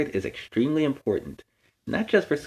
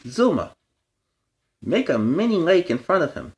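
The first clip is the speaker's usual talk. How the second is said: lightly raised commanding pup voice; Chase giving orders; 6 semitones higher than usual. A narrator's reading. Chase orders Zuma to make a lake, lightly raised command. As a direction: call out the order to the team.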